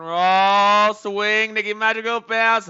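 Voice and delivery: slow build into a high, loud holler; rising commentator's shout; excited play call. Real, not acted. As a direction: stretch it out and erupt with the swing. Calling the play as it happens.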